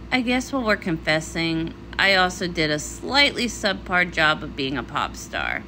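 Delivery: ordinary talk, nothing marked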